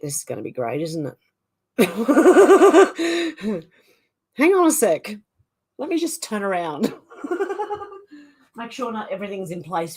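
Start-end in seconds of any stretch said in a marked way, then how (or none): 1.8-3.6 real helpless cackle; loud laugh. Real, not acted. Streamer laughing at getting too warm on air, loud high giggle. Laughs at own trouble undressing. 7.2-8.1 high, soft giggling; real trailing titter; giggle. Real, not acted. Streamer turning around from viewers, high soft giggle. Laughs while turning round.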